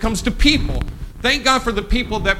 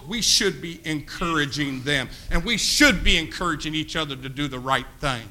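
usual speaking voice

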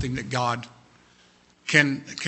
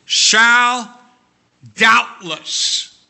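high, loud proclaiming of the words; booming pulpit proclamation; ringing proclamation; 7 semitones higher, 9 dB louder, much slower than usual. Acted. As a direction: ring out each word like a promise. Preacher proclaims key words.

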